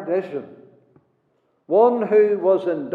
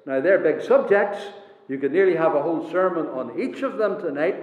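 ordinary talk

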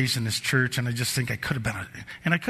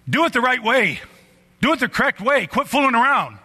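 loud, high coach's holler; yelling; 11 semitones higher, 5 dB louder than usual. Retold or imitated. Yelling at the boys warming up at the ball field. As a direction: yell it out at the whole field, high and hard. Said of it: "I yelled this four, five, six times".

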